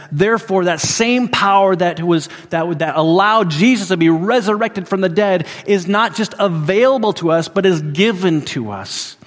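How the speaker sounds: high, loud, full-force preaching; fiery preaching. Acted. Preacher to his congregation on resurrection power, full-force preaching. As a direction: proclaim it to the whole congregation.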